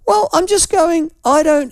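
squeezed high and pinched; pinched strained voice. Real, not acted. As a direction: strain up like your throat's dry. Ill man straining his voice.

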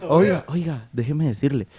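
soft, subdued request for the floor; toned down, gentle. Real, not acted. Panelist told to stop shouting asks softly to speak. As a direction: ask softly after being told to calm down.

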